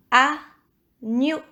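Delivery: agneau. split into a and gneau; syllable by syllable. Shown as a demonstration. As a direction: say "agneau." with a pause mid-word. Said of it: "Première ou deuxième syllabe".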